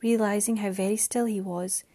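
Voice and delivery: ordinary delivery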